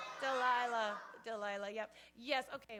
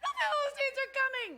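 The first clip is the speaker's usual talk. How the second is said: high alarmed shout of warning; yell; 11 semitones higher, 7 dB louder than usual. Retold or imitated. Delilah yelling to wake sleeping Samson, alarmed shout. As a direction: cry out the alarm to wake him. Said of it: "she yells".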